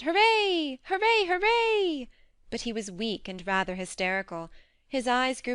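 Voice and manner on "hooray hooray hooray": high, loud hoorays; boy cheering out loud; shouted cheer. A narrator's reading. How a healthy boy would greet the news, shouted cheer. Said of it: "would probably have shouted".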